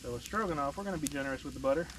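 ordinary talk

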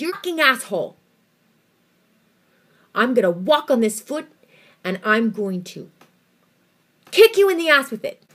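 shouted, pitch climbing to a yell at the end; furious outburst. Retold or imitated. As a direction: tell the doctor off with all your rage. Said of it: "with the disdain".

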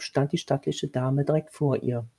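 ordinary delivery, nothing marked

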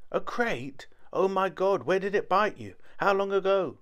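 panicked questions tumbling out, high and loud; alarmed. A narrator's reading. Narrator alarmed at snake news.

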